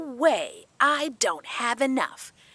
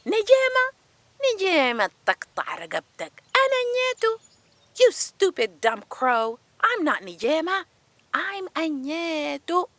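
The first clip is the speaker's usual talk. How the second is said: yelling back; 8 semitones higher than usual. A narrator's reading. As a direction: scold the crow in a high, restrained voice. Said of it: "And she yelled back".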